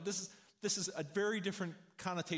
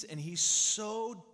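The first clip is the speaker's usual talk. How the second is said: louder, slower, straining with desperation; desperate intensity; 4 semitones higher, 6 dB louder, slower than usual. Acted. Preacher describing the rich man in torment, loud desperate strain. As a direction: lean in hard on the rich man's desperation.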